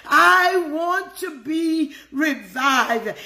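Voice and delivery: a slow, earnest prayer line; slow drawn-out plea. Acted. Preacher pleading to God, slowly drawn out. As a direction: stretch every word of the plea slowly.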